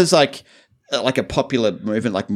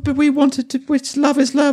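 very high whiny voice, pleading; high squeaky plea. Pretend. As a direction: squeak it out like a begging pet. Mock pleading of animal lovers.

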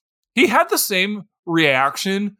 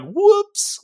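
very high, squeaky whoops. Retold or imitated. Boys knocking over a headstone, a very high squeaky whoops. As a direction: squeak it out playfully. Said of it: "we're just like".

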